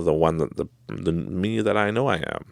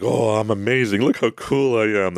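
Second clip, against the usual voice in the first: lightly hammed-up boasting, pitched up; self-admiring mock swagger, higher; mock pompous; 5 semitones higher than usual. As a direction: put on a mildly pompous brag.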